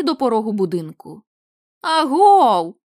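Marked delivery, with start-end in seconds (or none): 1.8-2.8 loud sudden call, pitched up; shouting out. Acted. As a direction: call out hard into the dark. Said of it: "гукнув Ларі". (гучний стривожений оклик)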